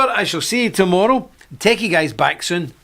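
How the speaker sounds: ordinary delivery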